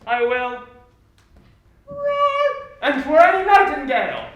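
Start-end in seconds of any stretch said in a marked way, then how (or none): 1.9-2.5 a tiny high-pitched roar; gentle roar. Acted. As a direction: roar like a sweet dove. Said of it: "roar you as gently as any sucking dove".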